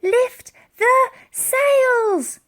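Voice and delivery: high, very slow, loud order; calling out. Acted. Elsie ordering the crew to lift the sails. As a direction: call the order to the whole ship. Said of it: "she called out".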